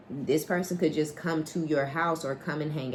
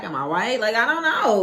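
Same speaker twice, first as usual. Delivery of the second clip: louder, higher outburst of bewilderment; exasperated confusion; 7 semitones higher, 7 dB louder than usual. Acted. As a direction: throw up a loud, high-pitched cry of not knowing. Acting out someone confused about themselves.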